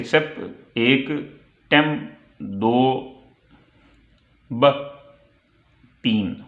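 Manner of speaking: सेप, टेम, ब each set apart by pauses and followed by its number; teacher's halting count: सेप एक, टेम दो, ब तीन; counting out syllables. Shown as a demonstration. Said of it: "तो ये तीन सिलेबल हैं".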